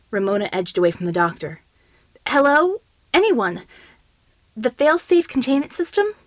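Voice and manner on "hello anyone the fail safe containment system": urgent call. Acted. Ramona calls out urgently.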